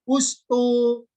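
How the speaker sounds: "ustu" sung out high and loud, clear u at the start; Arabic vowel demo. Shown as a demonstration. Teacher showing students the alif read as "ustu", high and loud. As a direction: stretch out "ustu" with a bright u. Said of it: "Kalau huruf ketiga doma, alifnya ikut doma".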